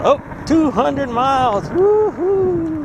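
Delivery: high, slow, cheering whoop; excited cheer. Real, not acted. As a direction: celebrate the milestone out loud. Celebrating the 200 mile mark.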